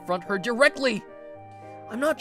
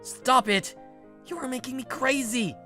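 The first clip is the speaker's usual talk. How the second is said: exasperated; 8 semitones higher than usual. Acted. Exasperated protest at bossy ex.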